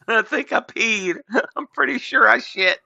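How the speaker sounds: cracking up while voicing the scared anchor; laughing through the words. Playing the fleeing news anchor, laughing through it.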